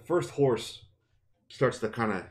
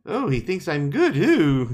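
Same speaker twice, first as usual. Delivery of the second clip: high, louder, swooning mock delight; Nynaeve suddenly flattered; 7 semitones higher, 7 dB louder than usual. Teasing Nynaeve for flipping from anger to delight, mock swooning. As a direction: coo it like a tickled admirer, hammed-up. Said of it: "She could be so angry, be like".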